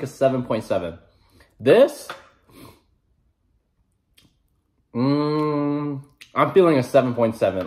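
muffled words between bites; talking while chewing. Real, not acted. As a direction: mouth partly full of food. Seen 4: Holds bitten egg roll; lips closed, jaw working between words.

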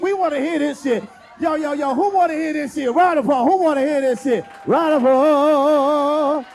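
loud hype-man call; rallying the crowd. Real, not acted. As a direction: whip the room into applause. Rallies crowd for applause.

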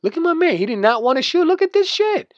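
mock quoting. Player quoting his opponent to viewers, high mock voice. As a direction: put on a high voice to quote him. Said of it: "And I'm quoting".

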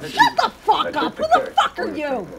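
yelled, high and loud, half laughing; loud shout. Acted. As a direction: bark it back at the heckler. Heckled at the movies.

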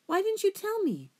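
very high, loud, agitated questioning; shrill demand. A narrator's reading. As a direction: demand answers at a shrill pitch. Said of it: "Lungwort demanded shrilly".

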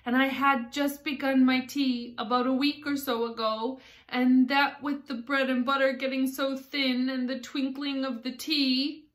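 a lightly acted frightened Hatter; trembling. A narrator's reading. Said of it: "began in a trembling voice".

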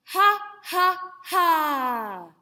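loud, resonant chest ha's; booming chest-voice ha ha ha. Shown as a demonstration. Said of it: "put our hands here".

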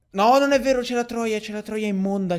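high, loud cry of disbelief; high-pitched exasperated protest; dismayed yell. Real, not acted. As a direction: shout in alarm at what appeared. Horrified at a new enemy appearing.

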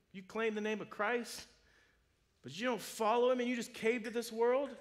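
loud, high-pitched pulpit fervor; full-force preacher's call; fiery preaching. Acted. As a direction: preach it out to the whole room, rising.

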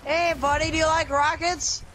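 mocking teammate, high sing-song threat; high taunting sing-song.

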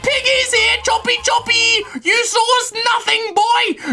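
very high, excited villain squeal; manic Piggy attack. Pretend.

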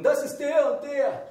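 high-pitched imitation of gawking onlookers; pointing passers-by. Passers-by point out a famous comedian, mock excited.

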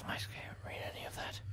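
hushed, breathy whisper, quick. Real, not acted. Player hiding in the game, whispering to himself. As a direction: whisper so the monster won't hear. Sneaking quietly past a monster.

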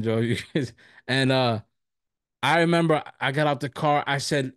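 usual speaking voice

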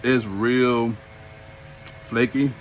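eating pastry while speaking; chewing while talking. Real, not acted. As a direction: mouth partly full of food. Seen 0: Bitten patty held at mouth; lips chewing through early and middle frames.

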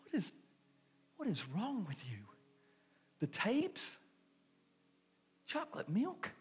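stunned buddy, soft but high; incredulous friend. Retold or imitated. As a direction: play the friend baffled by the cooler. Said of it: "he looks at me and he goes".

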